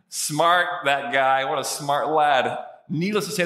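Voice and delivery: ordinary talk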